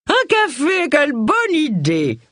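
put-on very high, slow nasal old-lady voice; nasal old aunt. A narrator's reading. Old aunt welcomes the coffee, high and nasal. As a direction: chirp it like a delighted prim old aunt.

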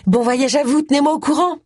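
high, doting introduction; high-pitched, perky wife voice; gushing wife. Acted. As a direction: show off the husband in a high voice.

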